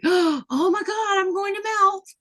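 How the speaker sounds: high, drawn-out mock panic for the dog; overdone high-pitched doggy melodrama. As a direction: voice the dog's dramatic horror at rain. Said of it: "It's like".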